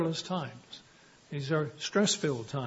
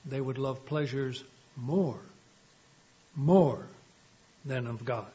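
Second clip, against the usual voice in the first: "more" set apart by pauses and repeated harder; stress on more; 3 semitones lower than usual. Real, not acted. Corrects misquote, repeats the key word.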